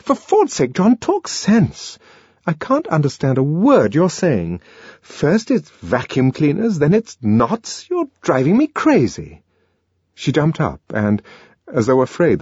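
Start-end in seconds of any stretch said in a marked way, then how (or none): 0.1-9.4 higher, impatient scolding voice; Lenina exasperated. A narrator's reading. Lenina scolding John for talking nonsense, exasperated.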